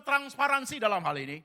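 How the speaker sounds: usual speaking voice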